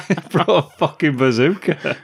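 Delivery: high, laughing exclamation; laughing disbelief. Real, not acted. Co-host revealing the villain's absurd weapon, laughing. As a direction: crack up as you tell it.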